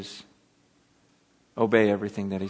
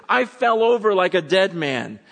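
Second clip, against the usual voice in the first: high, loud amazed retelling; acted astonishment; awestruck witness; 8 semitones higher, 6 dB louder than usual. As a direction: blurt it out as John, overwhelmed. Acts John awestruck by the vision.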